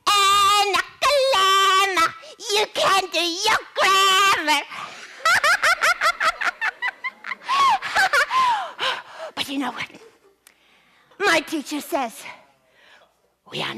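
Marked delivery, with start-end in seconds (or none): 0.1-4.7 loud sing-song teasing; mock taunt. Children tease Annie. 4.7-9.3 long shrill teasing giggle; mocking laugh. Children laugh at Annie.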